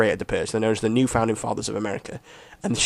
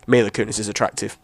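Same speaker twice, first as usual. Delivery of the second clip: normal talking voice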